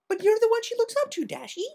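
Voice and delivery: hoch und schnell, quirlig; a bubbly, squeaky pony friend; Pinkie's voice. A narrator's reading. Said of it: "Pinkie Pie said".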